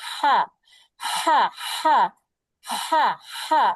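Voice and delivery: হা pressed from the middle of the throat with a raspy hiss; breathy throat ha. Shown as a demonstration. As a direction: squeeze the throat and push হা out as a hoarse breath. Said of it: "ফ্যাসফিসি আওয়াজ হবে গলা দিয়ে".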